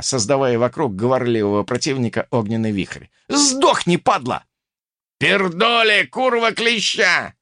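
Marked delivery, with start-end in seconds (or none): 3.3-7.3 high, angry shouts; fighting curses. A narrator's reading. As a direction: hurl curses high in the brawl. Cursing in the fight. (злобная ругань криком)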